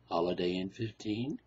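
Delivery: "Holiday Inn fifteen?" asked low and hushed, rising on "fifteen"; low hushed disbelieving echo of "Holiday Inn fifteen"; stunned doctor. Acted. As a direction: echo "fifteen" as if it can't be true.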